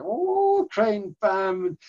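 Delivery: very high, hammed-up action narration; movie climax. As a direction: act out the big finishing kick. Acting out Karate Kid final scene.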